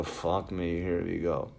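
low, quiet mock dread; weary resignation. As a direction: mutter it softly, resigned to the call. Dreading a phone call, imagined reaction.

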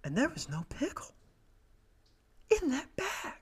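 tiny high-pitched voice; soft, squeaky disbelief with a long pause; mock heartbreak. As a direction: squeeze it out high and small. Mock heartbreak at the missing pickle.

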